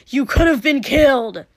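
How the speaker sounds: high, very loud scolding yell; angry snap. A narrator's reading. Katsuki berating his reckless friend, angry yell. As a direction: shout it in furious reproach. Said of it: "Katsuki snapped".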